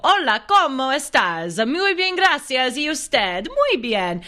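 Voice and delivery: high, hammed-up Italian lilt on Spanish; Spanish in Italian accent. Mimicking herself in high school Spanish class, Italian accent. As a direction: speak Spanish like a cartoon Italian. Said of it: "Spanish in an Italian accent".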